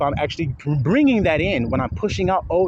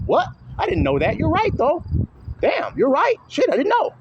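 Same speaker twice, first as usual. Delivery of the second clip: much higher, stunned dawning surprise; amazed realization; 11 semitones higher than usual. Retold or imitated. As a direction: act out the listener floored by it. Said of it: "You be like".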